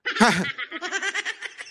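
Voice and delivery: a high, breathy burst of laughter; light high giggle. Real, not acted. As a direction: laugh it off, amused. Laughs at own joke.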